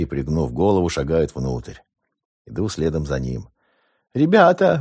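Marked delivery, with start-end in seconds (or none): 4.1-4.8 shrill high-pitched squeaky yell; squeaky shout. A narrator's reading. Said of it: "каким-то писклявым голосом кричит он". (писклявый крик)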